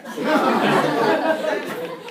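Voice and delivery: high breathless burst of laughter; loud shrieking laugh. Real, not acted. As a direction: crack up hard, high and loud. Laughing at own story.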